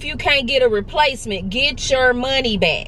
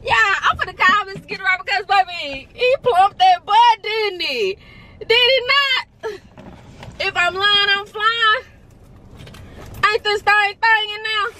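high hype voice; 9 semitones higher than usual. Real, not acted. Hyping up the butt doctor.

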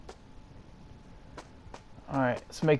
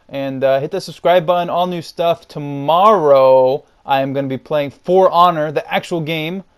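loud, pumped channel plug; louder YouTuber outro voice; upbeat outro sign-off; 5 semitones higher, 10 dB louder than usual. Acted. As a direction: sell the next videos with energy. Video outro and plugs.

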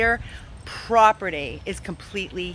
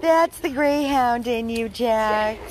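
higher, slow, delighted; praising the greyhound as it runs: higher, slow, delighted; pet talk; 6 semitones higher, slower than usual. Real, not acted. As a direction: praise the dog's speed fondly. Praising the running dog.

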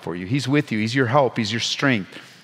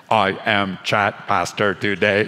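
mock robot pastor; 4 semitones lower, slower than usual. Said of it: "laughs before mock robot pastor".

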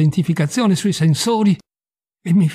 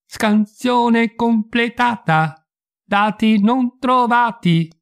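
slow, high, robotic announcement; cold machine reading out a result; emotionless robot; 8 semitones higher, much slower than usual. Acted. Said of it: "La voce robotica".

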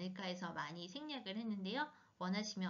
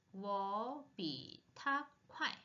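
slow drill; 2 semitones lower, much slower than usual. Shown as a demonstration. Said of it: "slow model reading".

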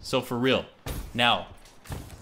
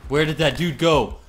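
ordinary talk

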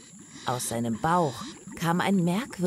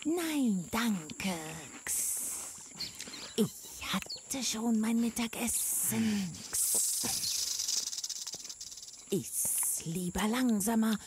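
soft, hissing sly snake voice; 6 semitones higher, 7 dB quieter, slower than usual. Acted. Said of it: "Kichernd und zischend".

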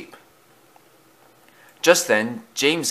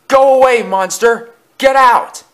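high, loud shout; frightened order; 13 semitones higher, 8 dB louder than usual. A narrator's reading. Thomas orders the monster out, high frightened shout. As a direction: order the monster away at full voice. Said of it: "ordered Thomas as he closed".

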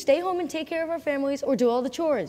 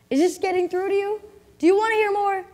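pressing harder; 5 semitones higher, 7 dB louder than usual. Acted. Father pressing daughter with hard truths.

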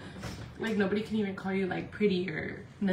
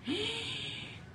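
sharp, high intake of breath; sudden audible gasp; 12 semitones higher, 10 dB quieter than usual. Real, not acted. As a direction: gasp in disbelief. Reacts to claim there is nothing.

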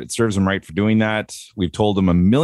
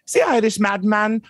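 usual speaking voice

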